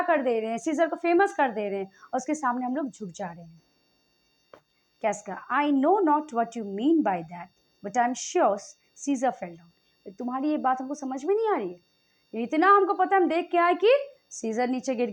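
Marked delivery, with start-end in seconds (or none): 5.5-9.7 slow, measured reading of the play text; Shakespeare reading. Acted. Teacher reading Casca's speech to the class, slow and measured. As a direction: read the old verse deliberately, word by word.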